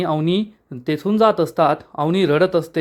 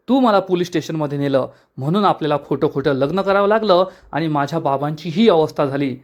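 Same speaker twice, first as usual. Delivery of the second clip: usual speaking voice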